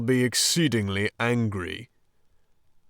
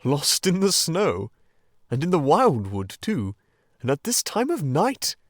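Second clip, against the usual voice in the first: louder, welcoming old badger; kindly Badger; 3 semitones higher, 6 dB louder than usual. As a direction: fuss over them warmly. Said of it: "in quite a different voice".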